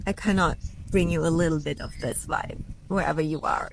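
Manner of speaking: normal talking voice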